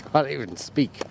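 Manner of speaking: normal talking voice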